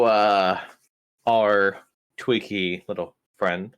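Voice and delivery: usual speaking voice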